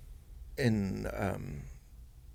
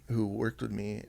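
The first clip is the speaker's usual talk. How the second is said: usual speaking voice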